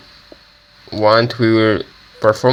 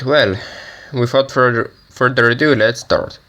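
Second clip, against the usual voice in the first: ordinary talk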